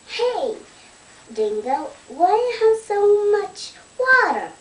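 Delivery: calling out. Pretend. Platypus calls to Dingo.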